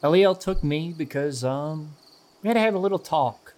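ordinary talk, nothing marked

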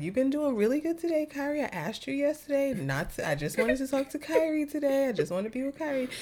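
put-on sweetly praising a child; imitating grandmother. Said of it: "abuela's like".

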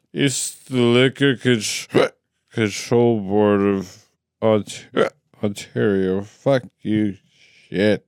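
put-on slurred drunk speech. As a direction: slur it like a drunk. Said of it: "That was pretty good".

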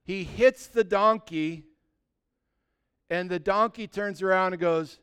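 ordinary talk

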